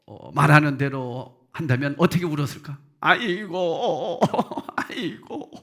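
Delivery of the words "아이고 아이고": drawn-out "aigo" lament; mock wailing. Shown as a demonstration. Preacher showing the congregation how mourners wailed, mock wail. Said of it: "이렇게 울었어요".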